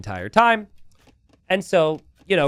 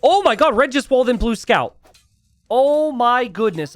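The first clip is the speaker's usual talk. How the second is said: sudden jump up in pitch and volume; real excitement as a caster watches a player get walled in; excited outburst; 8 semitones higher, 5 dB louder than usual. Real, not acted. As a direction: blurt it out, amazed at the play. Caster reacts to surprise wall.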